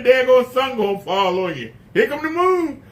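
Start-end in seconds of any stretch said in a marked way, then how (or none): none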